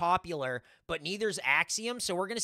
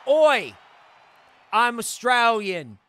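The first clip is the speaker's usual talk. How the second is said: loud Aussie greeting; 5 semitones higher, 9 dB louder, much slower than usual. Retold or imitated. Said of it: "Duke Hudson came out and he said".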